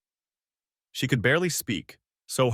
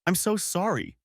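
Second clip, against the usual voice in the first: crying; 10 semitones higher than usual. A narrator's reading. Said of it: "she cried even harder".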